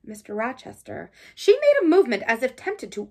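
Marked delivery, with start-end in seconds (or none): 0.0-1.1 softly, barely above a murmur; a hushed, timid address; low voice. A narrator's reading. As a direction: say his name quietly so as not to disturb the party. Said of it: "I said in a low voice".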